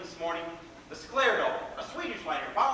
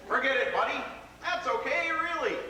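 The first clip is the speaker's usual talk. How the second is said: a stranger's breezy higher forgiveness; cheerful passerby; 7 semitones higher than usual. Retold or imitated. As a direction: toss it off like a friendly New Yorker. Passersby forgiving him, voiced.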